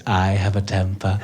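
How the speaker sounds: put-on low, slow, ominous old woman; a hint of a threatening granny; old lady menace. Comic voicing the old lady's warning, low and slow with mock menace. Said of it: "She goes".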